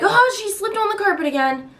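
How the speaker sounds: high startled exclamation; edgy alarm. Retold or imitated. Mom reacting as her toddler slips, high and alarmed. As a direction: gasp it out, alarmed.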